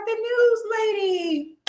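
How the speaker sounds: put-on high, slow little-kid answer; childlike voice.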